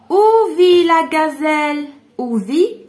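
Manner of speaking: slow, loud "Où vit la gazelle?" with stress on "où". Shown as a demonstration. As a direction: read the question out to the whole class. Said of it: "teacher reads question slowly".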